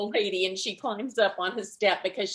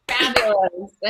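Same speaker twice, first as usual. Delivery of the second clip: drawn-out, louder diva-style fabulous; 2 semitones higher, 8 dB louder than usual. Real, not acted. Comic taking the stage, grandly savouring fabulous. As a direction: savour the word like a runway queen.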